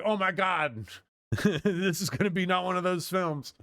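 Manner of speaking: high, hammed-up dismay with a laugh; freaked-out parents. Parents fearing what the kids will see, mock horror. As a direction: imitate an appalled parent, amused by it. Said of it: "'cause then they're like".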